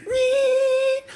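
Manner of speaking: piercing high, loud sustained squeal; imitated ear ringing. Lying in bed after a concert, imitated ringing ears. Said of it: "all you can hear is".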